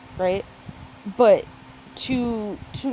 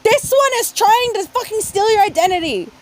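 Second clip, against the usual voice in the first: exasperated shout; 11 semitones higher, 6 dB louder than usual. Retold or imitated. Retold exasperated warning.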